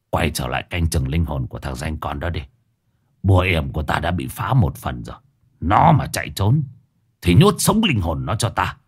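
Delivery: low, controlled menace of a dark master; low voice, rising in force toward the threat; sorcerer giving orders. Acted. Sorcerer ordering his spirit soldier back to guard a soul, low and menacing.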